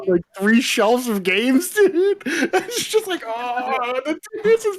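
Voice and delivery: high, slow, words stretched by laughter; laughing through words. Real, not acted. Retelling the shelf collapse to friends, laughing. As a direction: giggle through the story's end.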